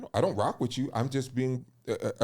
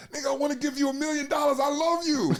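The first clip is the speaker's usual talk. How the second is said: much higher, fast, gushing with a laugh; hyped-up gassing tone; 14 semitones higher, faster than usual. Shown as a demonstration. Man demonstrating a gassed-up voice, high, fast gush. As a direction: pump them up like a superfan. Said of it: "most gas tone of voice saying".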